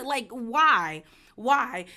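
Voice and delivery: loud, imitated baffled "why"; exasperated disbelief. Julia looking at Adriana across the table, exasperated. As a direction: push out each why in mock bafflement.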